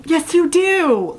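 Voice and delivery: high, slow, sing-out insistence; emphatic teasing retort. Real, not acted. Reader insisting to a fictional heroine, high teasing emphasis. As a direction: push YOU DO way up and stretch it.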